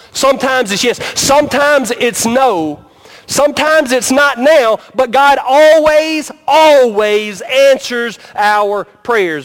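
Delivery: rising to a slow, emphatic preaching peak; building preaching. Acted. Preaching building on repeated lines.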